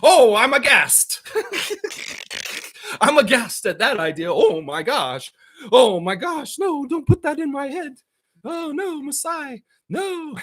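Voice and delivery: hammed-up shocked fan. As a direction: ham up an outraged old fan. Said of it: "The racist fans would be like".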